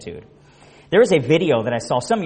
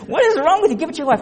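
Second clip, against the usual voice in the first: much higher, louder exasperated disbelief; incredulous; 13 semitones higher, 4 dB louder, faster than usual. Retold or imitated. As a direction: cry out what is wrong with you. Incredulous at coworker.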